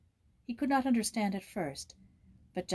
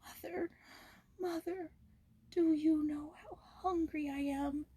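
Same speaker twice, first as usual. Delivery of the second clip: high, soft, slow tearful whisper; heartbroken whisper; 9 semitones higher, 5 dB quieter, slower than usual. A narrator's reading. A crying boy calling to his absent mother, heartbroken whisper. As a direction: whisper it through tears, lost. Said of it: "sobbed in a heartbroken whisper".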